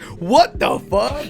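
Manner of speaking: loud, high, drawn-out mock shock; stunned disbelief. Film audience hearing the shark talk, acting stunned. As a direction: stretch it out slowly, aghast and loud. Everyone's stunned reaction, acted out.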